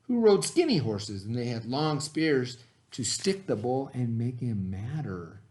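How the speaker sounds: put-on reading a story aloud to children; lively read-aloud for young listeners; expressive picture-book narration; storybook reading. A narrator's reading.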